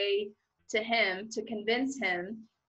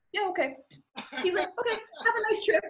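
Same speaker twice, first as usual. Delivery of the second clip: much higher, breezy offhand imitation; mock officer voice; 11 semitones higher than usual. Retelling how the officer breezily waves her through, high and offhand. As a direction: toss it off like a bored official.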